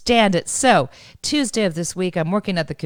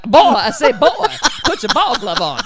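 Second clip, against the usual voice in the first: loud, higher blustering cartoon rooster; Foghorn Leghorn; 4 semitones higher, 6 dB louder than usual. Imagining how to talk to chickens, blustering rooster impression. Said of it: "doing my best foghorn leghorn".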